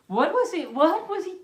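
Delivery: high incredulous what was he; stunned disbelief at the story; baffled. Real, not acted. Reader baffled by Ralph.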